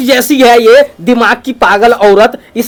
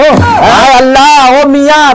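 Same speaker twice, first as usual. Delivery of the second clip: drawn-out wail; 2 semitones higher, much slower than usual. Acted. Wife wailing slowly.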